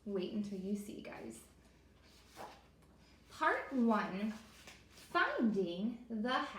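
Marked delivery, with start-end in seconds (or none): 3.3-6.5 slow, louder title read; announcing a chapter title. Acted. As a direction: present the chapter name to kids. Reads chapter title aloud.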